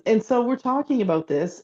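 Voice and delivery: ordinary talk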